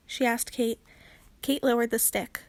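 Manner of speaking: ordinary delivery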